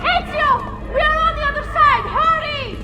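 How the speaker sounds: very high, full shout across a distance; far-off urgent yelling. Acted. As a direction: yell urgently to hurry someone along. Shouting to Ezio from other side.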